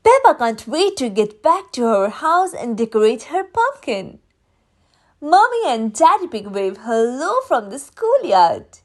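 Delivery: plain reading voice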